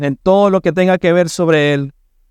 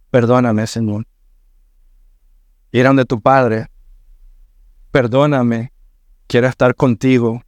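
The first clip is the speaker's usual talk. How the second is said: lower, hushed pleading prayer; humble prayer; 4 semitones lower than usual. Pretend. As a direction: pray for forgiveness as a repentant child. Voices a sinner's prayer.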